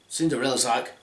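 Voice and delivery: ordinary delivery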